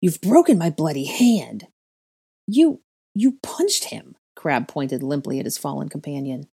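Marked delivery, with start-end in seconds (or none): 0.0-1.7 loud, outraged complaint; loud wail over an injured hand; pained cry. A narrator's reading. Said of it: "Draco cried". 2.5-4.2 high, loud, drawn-out disbelief; stunned, slow accusation; shocked stammer. A narrator's reading. Stunned henchman accuses Draco.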